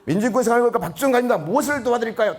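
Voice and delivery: fast, high, loud-ish official phone greeting. Acted. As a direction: rattle off the eager hotline greeting. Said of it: "다 이래요". (빠르고 높은 톤의 씩씩한 전화 응대)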